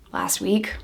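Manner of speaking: very soft, whispered reply after a sigh; deflated admission. Retold or imitated. Woman admitting to the cop about her parole officer, hushed and deflated. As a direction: breathe the answer out, barely voiced.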